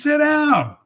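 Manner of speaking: high, loud retold command; firm order. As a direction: call it out like a paramedic ordering a patient. Retold paramedic giving an order.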